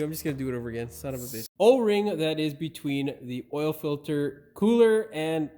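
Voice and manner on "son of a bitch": cursing under breath; muttered curse. Real, not acted. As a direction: grumble it low and soft. Muttered curse after flub.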